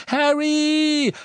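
high, loud shriek of a name. Acted. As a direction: shriek it in panic. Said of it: "kreischte Hermine".

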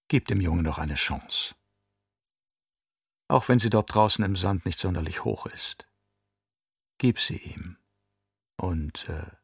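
low, soft persuasive voice; quiet enticing plea; coaxing. A narrator's reading. Jessica coaxes captor to spare boy.

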